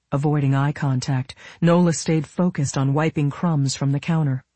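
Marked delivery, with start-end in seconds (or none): none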